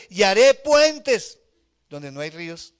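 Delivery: slow, high, loud hammed-up campaign promise; mock politician. Preacher mocking politicians' promises, hammed-up and loud. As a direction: lampoon an empty political promise. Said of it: "los políticos dicen".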